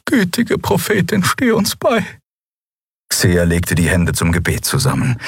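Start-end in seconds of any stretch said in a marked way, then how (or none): 0.1-2.0 high, pleading plea to the prophet; anguished prayer. A narrator's reading. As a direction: pray in dismay. Xea prays in horror. (hohes, flehendes Stoßgebet)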